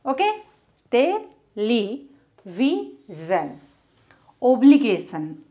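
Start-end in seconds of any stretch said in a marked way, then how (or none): 0.9-2.1 Television split into te-le-vi-sion; syllable by syllable. Shown as a demonstration. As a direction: space out every syllable of Television. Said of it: "how to divide words".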